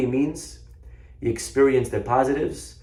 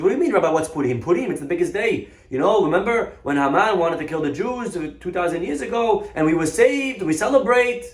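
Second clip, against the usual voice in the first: higher-pitched, climbing exasperation; incredulous protest; 7 semitones higher, 4 dB louder than usual. Acted. Shopkeeper explains Purim to rabbi, incredulous.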